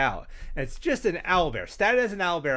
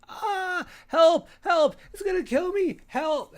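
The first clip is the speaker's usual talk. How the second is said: high, loud panicked calls for help; mock cries for help; 7 dB louder, slower than usual. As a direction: shriek for help like a terrified victim. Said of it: "they hear these cries for help".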